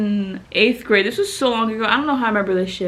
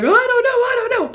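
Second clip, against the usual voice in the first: high, loud panicked wail; imitating panicked self on the phone; mock panic; 14 semitones higher, 3 dB louder, much faster than usual. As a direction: fret it out high and frantic.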